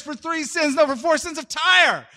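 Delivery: high, rising to a loud finish; yelled proclamation. Retold or imitated. Amos denouncing Tyre to the crowd, yelling. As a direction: build up to a shouted TYRE. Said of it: "he goes on like this".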